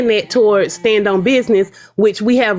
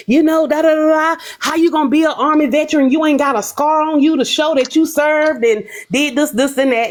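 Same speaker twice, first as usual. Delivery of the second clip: higher-pitched, heated rant; mock the furious woman losing it; retold angry rant; 5 semitones higher than usual. Retold angry rant by Pink Ninja.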